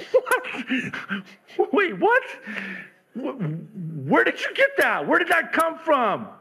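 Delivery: disbelieving what he just heard; stunned disbelief. Retold or imitated. Husband stunned by his wife's reply, in baffled disbelief.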